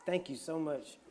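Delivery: normal talking voice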